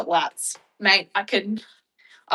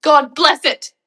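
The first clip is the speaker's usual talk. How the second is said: mom's exasperated curse; 8 semitones higher, 12 dB louder, slower than usual. Retold or imitated. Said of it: "my mom would say".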